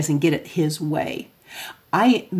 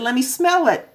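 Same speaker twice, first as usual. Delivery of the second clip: higher, light mock-suspicious mom asking her son; mock mom questioning; 7 semitones higher than usual. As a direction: play the doubtful mother checking up, pitched up. Mother demanding to check.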